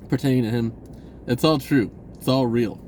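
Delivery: ordinary talk, nothing marked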